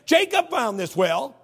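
loud, high challenge, drawn out in places; woman's challenge. Acted. Woman challenging Jesus over the well, loud challenge. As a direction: voice her challenge with big, punched-up volume. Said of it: "that's what she's saying".

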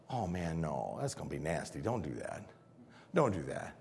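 low, soft, dreading mutter; hushed dread. Retold or imitated. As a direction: murmur like a queasy onlooker. Crowd dreading the tomb.

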